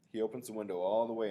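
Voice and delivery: plain reading voice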